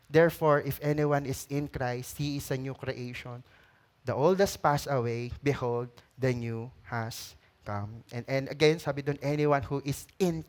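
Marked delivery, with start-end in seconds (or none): 0.7-8.0 soft reading. Acted. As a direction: read it gently, almost hushed. Reading verse softly.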